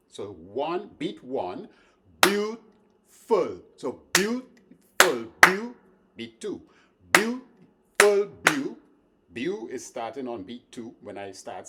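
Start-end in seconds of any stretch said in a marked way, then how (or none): 2.2-5.7 "beautiful" with "beau" hit high and loud, swung; swing emphasis. Shown as a demonstration. Teacher demonstrating swing feel on beautiful. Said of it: "emphasize beau". 7.1-8.8 "beautiful" with "beau" hit high and loud, swung; swing emphasis. Shown as a demonstration. Teacher demonstrating swing feel on beautiful. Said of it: "emphasize beau".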